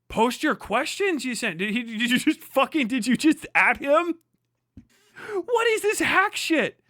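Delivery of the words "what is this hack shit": higher, slow, cracking exasperated cry; real outraged disbelief. Real, not acted. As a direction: wail it out in disbelief. Streamer's outrage at article.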